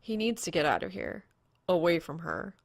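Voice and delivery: ordinary delivery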